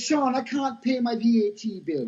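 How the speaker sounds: high-pitched, badly faked Jamaican accent; crap Jamaican accent. Factory owner pleading to Sean over VAT bill, mock Jamaican accent. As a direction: imitate an older Jamaican woman, accent deliberately rough. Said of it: "This is my crap Jamaican accent".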